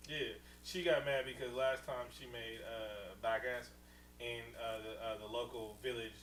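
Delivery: ordinary talk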